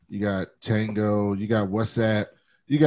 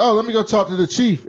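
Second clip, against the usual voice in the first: higher, louder mock entitled old man; old rich man; 10 semitones higher, 6 dB louder than usual. Old rich man voiced in retelling.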